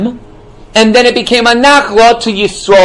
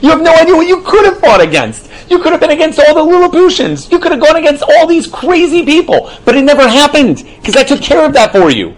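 much higher voice as God setting his people straight; God speaking; 8 semitones higher than usual. Acted. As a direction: speak as God, pitched well up. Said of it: "And Hashem's like".

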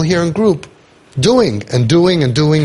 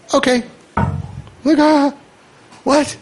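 mimicked parent; 11 semitones higher than usual. Mimics parents' response on the phone.

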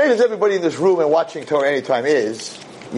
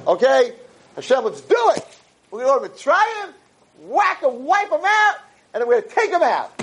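all excited; 10 semitones higher than usual. Acted. Moshe fired up, vowing to Hashem to save them, all excited. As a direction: charge in fired up for the mission. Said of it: "All excited".

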